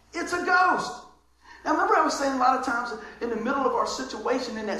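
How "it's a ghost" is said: high, loud cry of terror; terrified cry. A narrator's reading. Disciples in the storm crying out in terror. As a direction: shriek it out like you've seen a ghost. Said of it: "They cried out".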